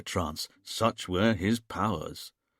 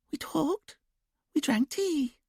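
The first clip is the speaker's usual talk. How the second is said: put-on much higher, slow sing-song child voice; childlike sing-song; slower than usual. A narrator's reading. Julia in trance answering the hypnotist, childlike sing-song. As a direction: sing it softly like a little girl. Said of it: "quiet, sing-song voice".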